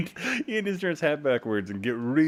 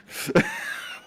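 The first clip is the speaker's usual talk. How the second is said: very high breathy squeal of laughter; squeaky laugh; 4 dB louder than usual. Real, not acted. Gamer squeals laughing at a weird chat remark. Reacting to odd chat line.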